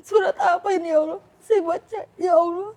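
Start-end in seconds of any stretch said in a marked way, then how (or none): 0.0-1.2 high, loud sobbing plea; sobbing high cry to God; crying prayer. Retold or imitated. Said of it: "Saya nangis". 2.2-2.7 high, loud tearful wail, drawn out slowly; tearful slow wail to God; crying. Retold or imitated. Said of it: "Saya nangis".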